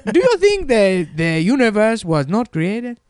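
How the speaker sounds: playful put-on African accent. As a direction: lecture in a high hammed African accent. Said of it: "what if they gave him an African voice".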